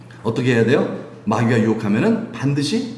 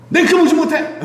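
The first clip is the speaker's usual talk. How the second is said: exasperated call; 5 dB louder than usual. Retold or imitated. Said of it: "이런 뜻이에요".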